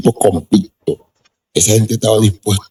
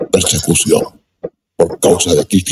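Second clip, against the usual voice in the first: ordinary talk, nothing marked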